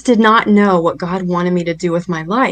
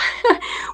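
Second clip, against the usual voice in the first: high airy laugh; 6 dB quieter than usual. Real, not acted. Laughs at the memory.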